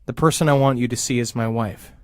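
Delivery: normal talking voice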